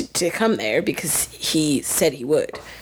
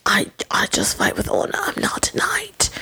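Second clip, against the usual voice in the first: whispered Brienne; 3 semitones lower than usual. Retold or imitated. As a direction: hush it like a humble knight's vow. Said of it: "She's always like".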